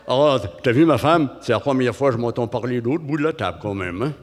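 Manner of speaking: René, hot potato voice. Retold or imitated. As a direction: talk like a hot potato in your mouth. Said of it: "une patate chaude dans la bouche".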